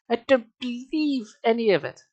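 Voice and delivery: put-on high, disbelieving girl; disbelief. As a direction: push it up in shock. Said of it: "Raising her hands to face".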